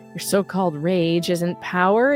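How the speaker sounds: normal talking voice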